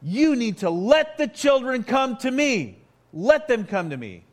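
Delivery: a firm, angry order; indignant command. Retold or imitated. Jesus ordering the disciples to let the children come, loud and stern. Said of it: "I don't think this was a soft-spoken word".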